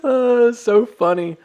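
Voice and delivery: winding down from a big laugh; slow, high, loud, tickled by own joke; real laughter still in the voice. Real, not acted. Recovering from a long laughing fit.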